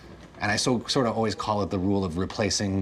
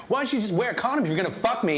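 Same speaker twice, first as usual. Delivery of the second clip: put-on kid voice; 14 semitones higher, faster than usual. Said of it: "He's like".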